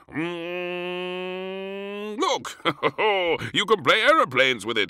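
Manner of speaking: high, loud, fast aeroplane game; whooshing playful king. Acted. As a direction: play aeroplanes with the fork. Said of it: "he whooshed".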